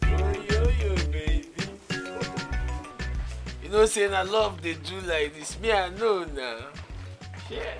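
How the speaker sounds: smooth sweet talk. Acted. Man sweet-talking.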